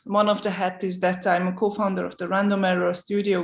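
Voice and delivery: ordinary talk, nothing marked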